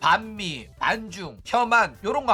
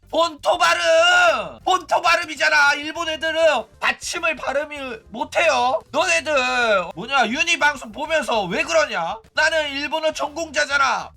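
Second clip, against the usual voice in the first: mock indignant outburst; 7 semitones higher, 4 dB louder than usual. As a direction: protest loudly, playing up the outrage. Mock outburst defending his pronunciation. (억울한 척 높은 목소리로 버럭 따지기)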